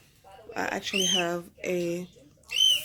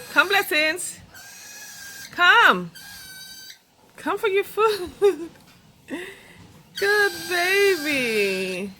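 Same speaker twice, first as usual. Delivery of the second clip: calling the pet; 10 semitones higher, 3 dB louder, slower than usual. Real, not acted. Calling the bird to its food.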